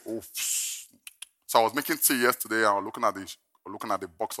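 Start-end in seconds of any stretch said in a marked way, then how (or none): 0.1-0.9 breathy guilty whisper; sheepish whisper; whispered oops. Retold or imitated. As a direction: whisper it like you're caught. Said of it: "I said".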